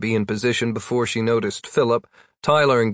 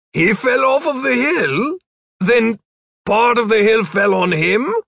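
amused disbelief; 11 semitones higher, 5 dB louder than usual. Acted.

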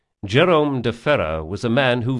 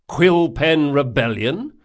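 slow, raised QUILL PEN REBELLION rising into a question; puzzled echo; 5 semitones higher, 6 dB louder, slower than usual. Acted. Passenger asking the boatman about an unknown name, puzzled echo.

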